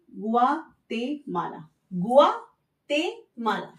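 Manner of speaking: गुआतेमाला slow, gwa-te-ma-la evenly spaced; slow syllable-by-syllable गुआतेमाला; Spanish pronunciation. Shown as a demonstration. Teacher repeats country name slowly for class. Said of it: "इसको भी बुलाते हैं".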